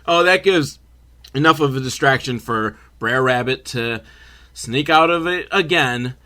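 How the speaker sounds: normal talking voice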